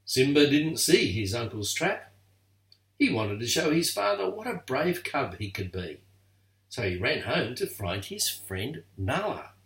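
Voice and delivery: usual speaking voice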